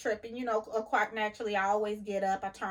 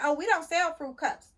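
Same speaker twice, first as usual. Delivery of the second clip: high, louder mock of the dismissive cashier; snippy cashier impression; 8 semitones higher, 5 dB louder than usual. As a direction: brush off the customer like the cashier did. Voicing the cashier girl.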